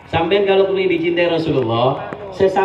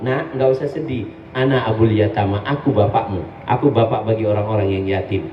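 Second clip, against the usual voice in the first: put-on lower, gentle fatherly voice comforting a crying child; tender fatherly prophet; 7 semitones lower than usual. The Prophet comforting an orphan on Eid, tender and low.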